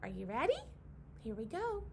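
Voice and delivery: ordinary delivery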